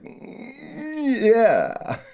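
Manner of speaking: put-on tense up like a flexing baby, then release; building toddler grunt into proud yeah; baby's building yeah.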